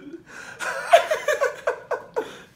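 real high breathy wheezing laughter. Real, not acted. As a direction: laugh till it's silent. Laughing after scaring scammer.

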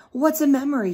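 put-on bright, eager little boy; child's question. Small boy asking the organ player. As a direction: ask like a curious child. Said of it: "he asked".

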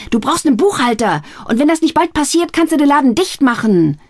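high, loud scolding rant; angry scolding. Acted. Sister berating her brother over the books, furious. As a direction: berate him, rising.

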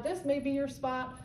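normal talking voice

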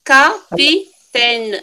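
slow, spelled-out capitaine; slow syllable count. Shown as a demonstration. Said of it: "Alors, comptez maintenant".

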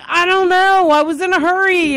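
high, loud protest; retold whiny excuse. Daughter explains herself to mother, high protesting excuse. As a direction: plead your excuse up high.

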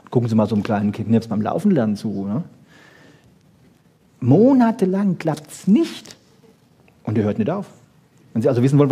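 ordinary talk, nothing marked

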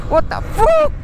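sudden high indignant squeal; shocked squeal. Real, not acted. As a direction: cry out in disbelief. Complaining about the cold.

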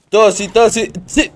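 más agudo y fuerte, feliz; saludo emocionado. Real, not acted. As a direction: saluda con entusiasmo. (saludo eufórico y agudo)

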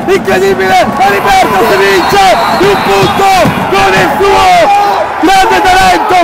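screaming ecstatic buzzer-beater call; breathless italian goal commentary. Real, not acted. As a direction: yell it like the winning shot.

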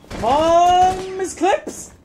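long, high "come on"; drawn-out urging. Real, not acted. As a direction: urge them on with a held cry. Urging teammate in the game.

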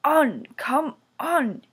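little engine urging the trucks along; puffing engine. A narrator's reading. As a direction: chuff it out with impatient eagerness. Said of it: "he puffed".

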